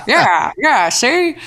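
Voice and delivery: high, loud 1930s mobster "see?"; old-timey gangster. Pretend. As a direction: ham up an Al Capone type. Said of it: "it's Al Capone or something".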